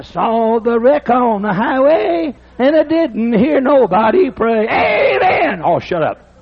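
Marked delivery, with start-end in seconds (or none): 4.7-5.6 high shouted amen then scoff; mock amen shout. Preacher mocking a shouting worshipper, mock shout. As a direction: yell amen, then cut it off.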